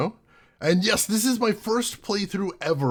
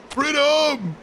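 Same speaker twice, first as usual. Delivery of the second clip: excited shout; 12 semitones higher, 4 dB louder than usual. Real, not acted. Shouting along with the game.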